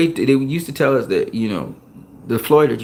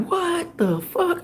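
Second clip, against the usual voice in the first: high, slow, soft squeal of disbelief; stunned disbelief; 5 dB quieter, much slower than usual. Retold or imitated. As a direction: draw it out softly in astonishment, way up high. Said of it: "I couldn't believe what I was seeing".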